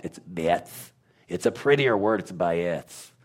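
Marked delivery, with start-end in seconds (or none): 0.2-0.9 BETH ending in a soft th; shown pronunciation. Shown as a demonstration. Correcting a listener on the letter, demonstrated pronunciation of Beth. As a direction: say Beth with th, not a hard t. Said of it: "It's not a bet or a vet".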